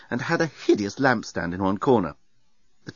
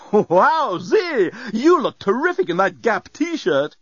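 high, slow, gushing robot compliment; excited robot exclaim; 11 semitones higher, 3 dB louder, slower than usual. A narrator's reading. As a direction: overeager bellbot gushing. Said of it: "it exclaimed".

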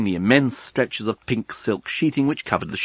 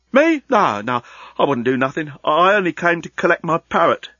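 shifty workman pleading innocence; protesting worker; 5 semitones higher than usual. Acted. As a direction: start with a high startled squeak, then bluster.

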